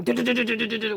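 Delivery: rattling du-du-du of the ambulance over bumps; imitated bumping noise. Recalling the bumpy ambulance ride, imitating the jolts. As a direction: mimic the jolting ride with a stuttered du sound. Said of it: "it was like they were going".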